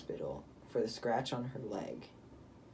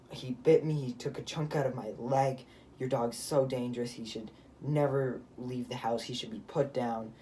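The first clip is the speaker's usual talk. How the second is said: retold accusing outburst; 4 dB louder than usual. Teen imitating the woman accusing the family's dog, indignant. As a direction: imitate an indignant accuser, hitting the opening hard. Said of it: "she goes from, like".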